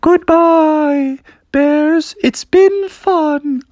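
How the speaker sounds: tiny high farewell voice, drawn out slow; a small toy voice saying goodbye; the fallen wagon bidding the bears farewell; little wagon voice. Acted. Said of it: "a little voice saying".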